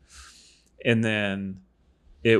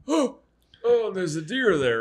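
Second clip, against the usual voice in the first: high, gasping excitement; suddenly thrilled, voice jumping up; spotting the deer through the phone camera, excited; 11 semitones higher than usual. Retold or imitated.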